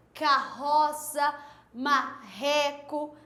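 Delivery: carroça and marreco said slowly, RR as a guttural h; slow RR demo. Shown as a demonstration. Teacher drawing out RR words for pupils, slow demo. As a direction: stretch each word to show the RR. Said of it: "não tem esse sonzinho de R".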